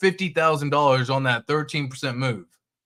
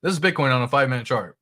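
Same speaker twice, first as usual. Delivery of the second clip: usual speaking voice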